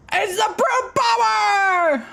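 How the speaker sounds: high, slow ringside call; wrestling commentator. Acted. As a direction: call the big entrance like ringside.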